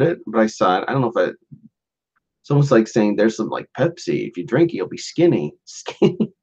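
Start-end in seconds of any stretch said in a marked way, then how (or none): none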